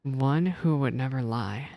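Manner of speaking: ordinary talk, nothing marked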